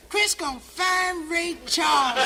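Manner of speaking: put-on high, slow, loud drunken call; hammed-up tipsy woman calling out. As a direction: drawl it out like she's had a few. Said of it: "Isabel saying".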